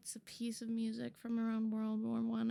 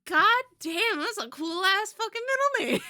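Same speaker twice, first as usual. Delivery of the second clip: loud, high, fast and laughing with delight; thrilled shout; 10 semitones higher, 12 dB louder, faster than usual. Real, not acted. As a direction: hype it up at full volume. Thrilled by viewer's name.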